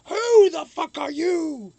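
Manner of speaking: high, loud rock scream; screamed lyric. Retold or imitated. Imitating the singer screaming the song line, screamed. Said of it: "part of the song where he screams".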